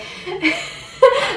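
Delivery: high burst of laughter. Retold or imitated. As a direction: laugh in amused disbelief. Husband reacts to her.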